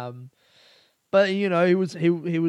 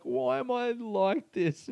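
high, slow lament; self-pitying whine; 8 semitones higher, slower than usual. Retold or imitated. Laughing at himself to housemate, slow high lament. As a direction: moan it at yourself. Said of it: "I was just like".

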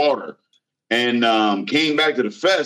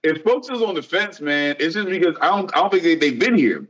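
upbeat pitchman pitch, raised high; salesman voice; 6 semitones higher than usual. Acted. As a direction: sell the festival like a salesman. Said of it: "He got the salesman voice".

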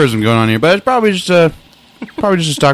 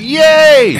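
high, loud gleeful whoop; mock happy cheer; 6 dB louder than usual. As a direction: imitate someone cheering as he runs. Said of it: "He looks so happy".

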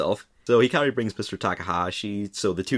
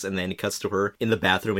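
ordinary talk

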